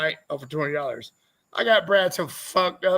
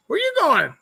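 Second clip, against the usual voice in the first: high mock drunk girl voice, louder; drunk woman; 11 semitones higher, 5 dB louder than usual. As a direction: squeal it like a drunk girl outside the bar.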